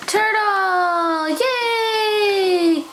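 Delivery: high cheering yay; excited praise. Real, not acted. Praising the toddler.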